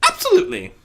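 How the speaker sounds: high, loud, firm Rarity voice; firm proclamation. Acted. Rarity firmly assures Fluttershy. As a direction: declare it with total conviction. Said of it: "Rarity proclaimed firmly".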